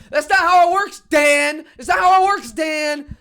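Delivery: loud, high, ranting at the coach; exasperated yell. Real, not acted. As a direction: shout it at Dan like he can hear you. Yelling at the coach.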